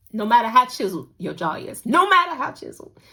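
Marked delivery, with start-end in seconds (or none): 1.9-3.0 high, exasperated repeat; exasperated squeak. Real, not acted. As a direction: squeak it in disbelief. Exasperated repeat about jaw.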